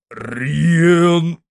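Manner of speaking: calling out. A narrator's reading. Calling to the coachman.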